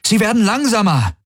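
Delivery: urgent, very high-pitched exclamation; calling out. A narrator's reading. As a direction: cry it out in sudden realisation. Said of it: "rief Galen".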